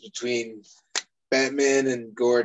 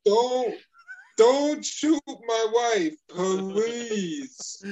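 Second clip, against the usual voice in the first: mock begging; 9 semitones higher, much slower than usual. Gordon begging Harvey for his wife, high slow mock plea. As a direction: beg drawn out like a soap opera.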